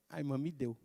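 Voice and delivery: put-on a small boy answering quietly; lightly voiced toddler. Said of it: "daquele jeito de criança falar".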